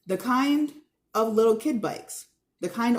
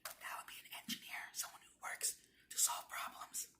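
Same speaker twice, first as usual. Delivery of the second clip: much softer, whispered note; whispered aside; 21 dB quieter than usual. Real, not acted. Teacher adding a side note to the class, whispered. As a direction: whisper it to yourself.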